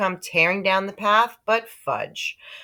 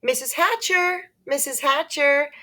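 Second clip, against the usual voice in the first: high eager calling out; eager girl calling; 11 semitones higher than usual. Acted. Sheila calling to Mrs. Hatcher, high eager call. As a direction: call for attention in a high girlish voice. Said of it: "Sheila called".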